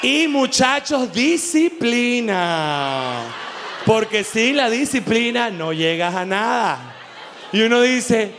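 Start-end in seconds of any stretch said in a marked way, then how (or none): 0.0-7.0 slow, drawn-out motivational preaching; mock fitness guru. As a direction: preach discipline like a smug influencer. Parodies a fitness influencer preaching.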